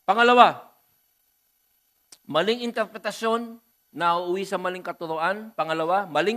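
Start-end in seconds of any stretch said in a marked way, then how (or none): none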